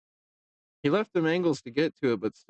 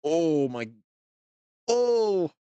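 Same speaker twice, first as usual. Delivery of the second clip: surprised; 4 semitones higher, much slower than usual. Real, not acted. Commentator reacts to a shot.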